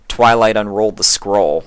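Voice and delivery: ordinary delivery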